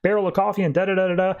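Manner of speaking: rapid breathless-style run-on list; rambling on. Retold or imitated. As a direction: rattle it off as mock endless chatter. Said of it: "goes immediately on this, like, long thing".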